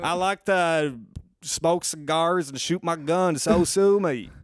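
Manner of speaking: put-on Southern good old boy; Southern accent. Comic topping the guest's country riff, Southern accent. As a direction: list his pleasures like a Southern country boy.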